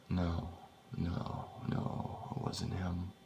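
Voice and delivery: soft, fading denial under the breath; hushed disbelief. A narrator's reading. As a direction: murmur it to yourself, refusing to believe. Shaken narrator denies recognizing the voice.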